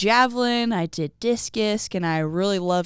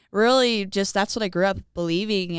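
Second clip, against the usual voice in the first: ordinary talk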